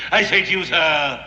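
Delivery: normal talking voice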